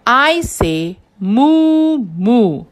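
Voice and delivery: slow, drawn-out first reading; slow riddle read. Shown as a demonstration. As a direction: spell out "moo moo" slowly for the kids. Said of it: "I am an animal".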